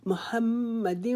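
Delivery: محمد with the م held one alif long; slow recited محمد; held doubled mim. Shown as a demonstration. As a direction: stretch the doubled م in محمد, then fall. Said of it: "اس کے اوپر ہم ایک الف کے جتنا رکیں گے".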